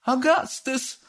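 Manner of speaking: very high fussy mother's cry; high-pitched mother calling her boy. Acted. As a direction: call out to your son like a doting mother. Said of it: "раздался крик миссис Глуп".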